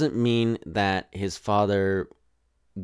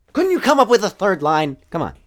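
much higher and louder, whiny disbelief; mock-whining at Wisdom for being unwise; exasperated plea; 8 semitones higher, 6 dB louder, faster than usual. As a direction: beg her to find a third way, fed up.

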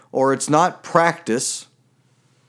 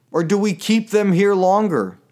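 normal talking voice

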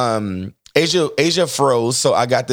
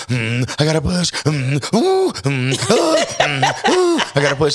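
comic higher-pitched grunts and hoots; Asia straining in labour; 4 semitones higher, slower than usual. Retold or imitated. Husband imitates wife fighting the urge to push, comically straining. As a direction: mimic labour pushing with strained moans. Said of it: "'Cause you, she's like".

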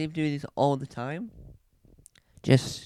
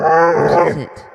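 scared shout; 2 semitones higher, 10 dB louder, slower than usual. Acted. Terrifying noise returns.

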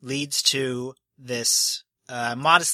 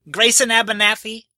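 higher and louder, announcing each name; formal naming; 8 semitones higher, 7 dB louder than usual. Acted. Host calling out a guardian by name, loud. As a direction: call the name out like a herald. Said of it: "he says".

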